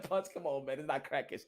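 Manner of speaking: a friend pushing back, softly laughing; laughing soft quote. Retold or imitated. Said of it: "he said".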